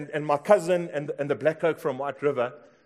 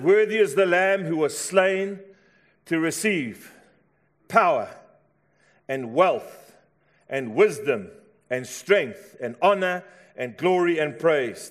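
louder, slow proclamation; shouted praise; 5 dB louder, slower than usual. Acted. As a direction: proclaim it like the heavenly crowd. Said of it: "And they shouted".

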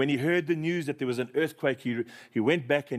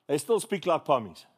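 ordinary talk

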